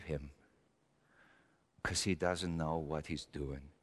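put-on low, soft, heavy-hearted confession as the old persecutor; a remorseful Saul remembering the stonings; Paul confessing, hushed. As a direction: murmur it like a man ashamed of his past. Paul confessing his past, in character.

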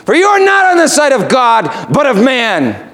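high, forceful rebuke; Jesus rebuking. Acted. Preacher voicing Jesus rebuking Peter, forcefully. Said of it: "Remember what Jesus says".

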